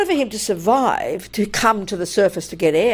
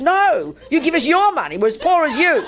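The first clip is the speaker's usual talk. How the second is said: high-pitched retold refusal; indignant lecture; 6 semitones higher than usual. Woman refusing the gunman and lecturing him, indignant. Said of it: "I was giving a lecture".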